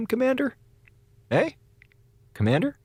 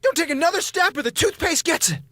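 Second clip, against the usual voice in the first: defiant bravado; 10 semitones higher than usual. Acted. Hostage standoff, defiant bravado.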